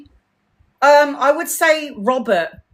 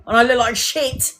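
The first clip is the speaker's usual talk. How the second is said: usual speaking voice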